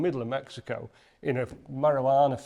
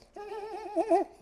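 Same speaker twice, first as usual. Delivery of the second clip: put-on high giggling little-boy laugh; much higher imitated child laugh; mocking child laugh. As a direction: cackle like a mocking kid. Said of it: "he's laughing at us".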